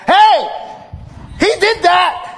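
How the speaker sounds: mock triumphant accusation; accusing shout. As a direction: call out the guilty man to the crowd. Villagers accusing a drowned man.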